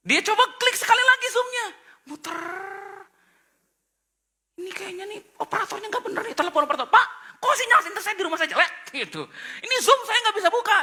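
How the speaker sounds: high, loud, laughing and rushed; frantic storytelling. Acted. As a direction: pile up the panic, laughing. Preacher acts out frantic Udin. (heboh dan panik sambil tertawa)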